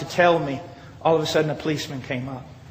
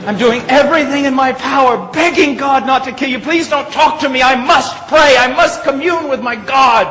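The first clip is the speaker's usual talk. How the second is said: high, loud, urgent retold warning; impassioned shouted plea; 9 semitones higher, 11 dB louder than usual. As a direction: cry out as if holding back judgment. Preacher retells his urgent warning.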